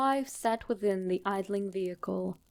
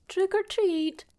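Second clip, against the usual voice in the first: lightly voiced cheerful child's call, higher; kids' trick or treat; 11 semitones higher, 3 dB louder than usual. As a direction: chirp it like a small trick-or-treater. Narrator voices kids' reminded greeting.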